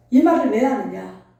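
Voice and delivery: ordinary talk, nothing marked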